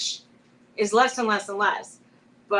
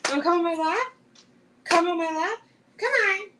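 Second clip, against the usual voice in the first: high, cooing pet voice; coaxing a dog; 8 semitones higher than usual. Retold or imitated. Owner inviting her dog onto her lap, high coaxing voice. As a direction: coax a little dog up in a high voice.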